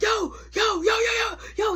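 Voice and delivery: high shouted yo yo calls across the street; calling out. Retold or imitated. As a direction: call out to a friend while running past. Calling out to Raymond while running.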